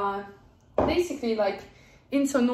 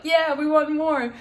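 higher, drawn-up eager mock plea; imitating eager children; 5 semitones higher, 4 dB louder than usual. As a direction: retell the kids begging for more. Retold children eagerly asking for more.

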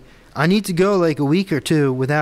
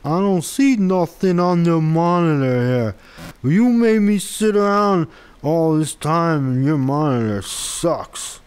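mock gripe, higher-pitched put-on voice; complaining viewer; 4 semitones higher, 3 dB louder, slower than usual. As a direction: play a disgruntled viewer whining loudly. Mimics a complaining viewer, then answers.

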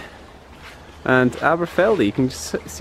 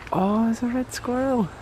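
higher, soft excited gasp; hushed excitement; 9 semitones higher, 5 dB quieter than usual. Real, not acted. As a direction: point it out in a thrilled hush. Spots squirrel, hushed.

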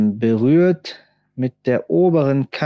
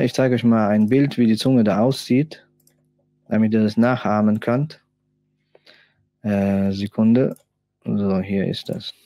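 normal talking voice

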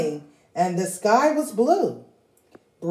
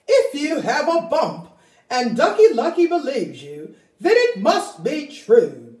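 Turkey Lurkey voice; 6 semitones higher, 3 dB louder than usual. Acted.

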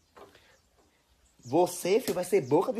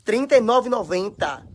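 louder, slow, each number stressed; emphatic price; 2 semitones higher, 7 dB louder than usual. Real, not acted. Woman complains about the chewed sandal's price, slow and emphatic. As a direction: lean hard on every number, indignant.

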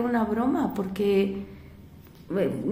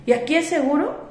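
mock sneer; 6 semitones higher, 3 dB louder than usual. Driver sneering question, retold.

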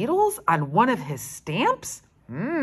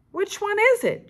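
shriek; 6 dB louder, faster than usual. Acted. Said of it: "Judy shrieked".

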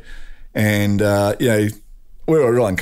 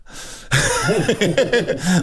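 high, sustained laugh; real laughter; 8 semitones higher than usual. Real, not acted. Storyteller laughing at his own anecdote, high real laughter. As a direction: burst out laughing at the punchline. Laughs at the Pearl Harbor retort.

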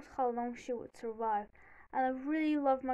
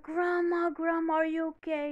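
higher, louder alarmed call; retold panic; 5 semitones higher, 5 dB louder than usual. Finding grandma on the floor, panicked. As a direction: re-enact calling out in a panic. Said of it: "panicked and everything like that".

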